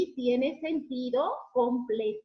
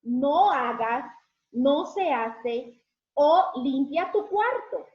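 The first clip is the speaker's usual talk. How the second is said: slow, louder stern orders; scolding commands; 7 dB louder, slower than usual. Shown as a demonstration. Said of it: "una orden o prohibición".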